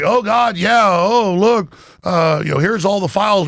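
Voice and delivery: high-pitched, put-on realization; mock confession. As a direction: voice a mocking "oh, look what we found". Voices a magazine's sudden confession.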